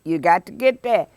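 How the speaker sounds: normal talking voice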